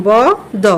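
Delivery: ব, দ as separate sounds, open vowel; BOTH as in bother. Shown as a demonstration. Teacher sounds out start of bother, ব then দ separately. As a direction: split it into ব, then দ. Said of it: "এটার উচ্চারণ হবে এরকম".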